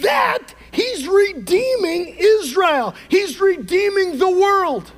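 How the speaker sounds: loud, high, slow preaching; impassioned sermon climax, drawn out; full-force preaching. Real, not acted. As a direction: preach it with everything, slowly.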